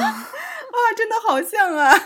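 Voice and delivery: high, loud words through real laughter; laughing hard. Real, not acted. Delighted by her co-host's accent impression, laughing. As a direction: crack up while talking.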